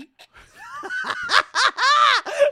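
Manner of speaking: sudden loud cackling laugh; loud burst of laughter. Real, not acted. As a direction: explode into real laughter. Bursts out laughing mid-sentence.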